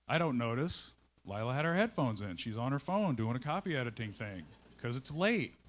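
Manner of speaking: usual speaking voice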